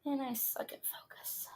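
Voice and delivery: breathy whispered aside; whispering. Real, not acted. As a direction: mutter it under your breath. Muttered aside while setting up.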